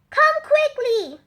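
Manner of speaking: high, loud shout; urgent call. Acted. Sam urging a few villagers, high loud call. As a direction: call them to hurry.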